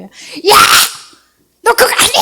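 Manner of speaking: high, loud fishmarket-auntie bark; fishwife scolding. Retold or imitated. Preacher scolding locals in Liberia like a fishwife, loud high yell. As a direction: yell like a Busan market auntie. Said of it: "생선 파는 아줌마의 목소리더라고요".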